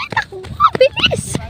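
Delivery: high excited shout; calling out. Real, not acted. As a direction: yell at them to run. Urging others to run.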